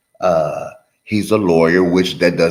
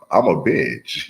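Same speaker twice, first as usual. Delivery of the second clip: ordinary talk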